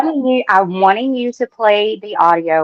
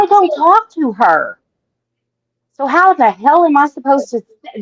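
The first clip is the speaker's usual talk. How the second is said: higher, heated protest; real exasperation; 5 semitones higher, 3 dB louder than usual. Real, not acted. Accused caller protesting to the streamer, exasperated. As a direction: push back indignantly, pitch jumping up.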